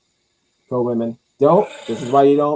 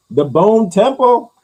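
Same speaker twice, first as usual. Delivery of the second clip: slow, loud, higher announcement; hyped title call; 9 semitones higher, 9 dB louder, slower than usual. Real, not acted. Hyping the movie title.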